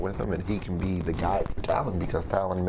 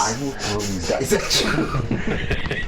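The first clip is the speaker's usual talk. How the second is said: louder, drawn-out threat through laughter; laughing mock threat; 3 semitones higher, 4 dB louder, much slower than usual. Player threatens friend over dice, laughing mock threat. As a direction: threaten playfully while cracking up.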